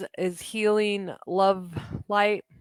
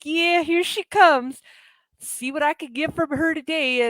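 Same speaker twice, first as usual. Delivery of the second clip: mocking imitation; 5 semitones higher than usual. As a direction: imitate someone sizing up an easy target. Mimics smug users watching her approach.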